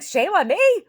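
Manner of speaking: high, loud mock disbelief; exaggerated indignant echo; retold indignant retort. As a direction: throw it back as an incredulous question. Retelling own indignant reply.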